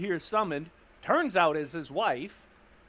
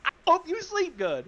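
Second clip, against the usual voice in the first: high-pitched, protesting requote; innocent requote; 11 semitones higher than usual. Retold or imitated. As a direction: repeat it high as if obviously harmless. Requotes the line as harmless.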